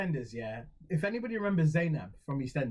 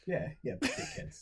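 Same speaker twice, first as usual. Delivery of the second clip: sneering mock; 7 dB quieter, slower than usual. Mocking the French signs.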